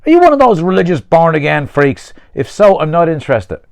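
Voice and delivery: loud, high-pitched brush-off; hostile challenge. Acted. Peter suspecting a preacher, loud hostile challenge. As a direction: confront him and shut it down.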